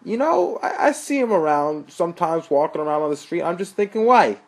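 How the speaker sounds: put-on high, loud New York accent; brash New York street guy. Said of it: "Joe said in a New York accent".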